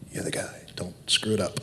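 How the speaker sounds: a hushed, unvoiced aside from the director; whispered aside. Director whispers encouragement to the nervous actor, hushed. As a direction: lean in and whisper it like a secret.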